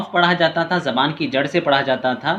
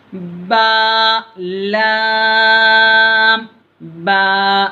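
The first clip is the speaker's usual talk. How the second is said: slow, high, louder chanted lam alif; drawn-out recitation; 6 semitones higher, 5 dB louder, much slower than usual. Shown as a demonstration. Teacher demonstrates the lesson's letters to pupils, slow chanted and louder. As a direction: hold लाम very long, then a falling अलिफ. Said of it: "लाम को ज़्यादा खींचे".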